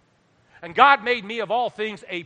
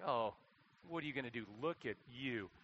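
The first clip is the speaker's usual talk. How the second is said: taunting inner voice; 6 semitones lower, 16 dB quieter than usual. Acted. Voicing the taunting thoughts in the mirror.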